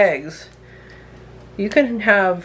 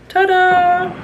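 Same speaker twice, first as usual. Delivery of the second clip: high, loud showy reveal; triumphant ta-da; 13 semitones higher, 4 dB louder than usual. Real, not acted. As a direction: sing it out like a magic trick. Reveals the opened jar.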